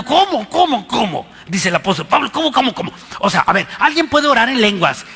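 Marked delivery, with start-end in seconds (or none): 0.0-1.3 high, loud mock bewilderment; sarcastic squeaky cómo cómo cómo; mocking how how how. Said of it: "Se está burlando". 2.3-2.9 sarcastic squeaky cómo cómo cómo; mocking how how how. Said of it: "Se está burlando".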